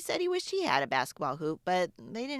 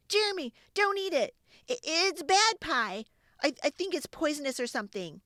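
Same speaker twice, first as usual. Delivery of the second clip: much higher, loud and hurried, stumbling; panicked warning; 9 semitones higher, 4 dB louder than usual. Acted. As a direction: blurt it out in a panic to stop him. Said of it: "I panicked".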